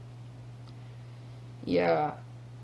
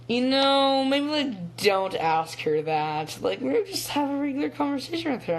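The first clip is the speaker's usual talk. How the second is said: lightly voiced mom, pitched up; mom's gentle discouragement; 8 semitones higher than usual. Mom steering her child off a question, lightly imitated tactful voice.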